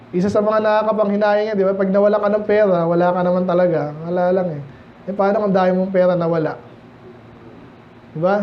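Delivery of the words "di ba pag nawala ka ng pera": normal talking voice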